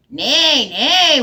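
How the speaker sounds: loud, lightly voiced neigh; whinnying horse. Horse tells the hen he'll go instead, with a loud neigh. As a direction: neigh the words like a mild horse. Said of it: "whinnied the horse".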